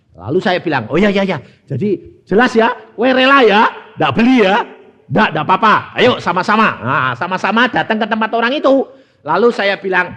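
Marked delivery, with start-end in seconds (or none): 0.9-6.7 eager agreement. Retold or imitated. Cheerfully agreeing with his wife.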